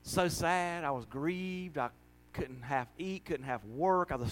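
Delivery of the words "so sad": ordinary talk, nothing marked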